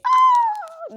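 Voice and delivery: a high, loud, ringing sung "ah"; high pure ah. Shown as a demonstration. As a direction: let one high bright note ring out as the beautiful sound. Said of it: "I could open up my mouth and say".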